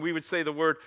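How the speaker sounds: usual speaking voice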